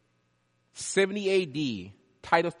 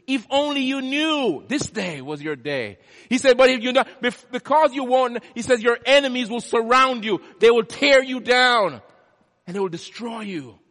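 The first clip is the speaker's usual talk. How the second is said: Jesus warns the city of its enemies, loud; Jesus warning; 9 semitones higher, 11 dB louder than usual. Acted. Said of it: "came to them and says".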